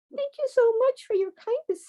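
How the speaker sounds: high, tiny pleading mouse voice; little mouse voice. A narrator's reading. Adult reading a bedtime story, voicing the mouse: high and small. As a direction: squeak it out like a small scared mouse.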